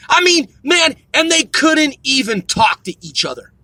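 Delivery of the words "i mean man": ordinary talk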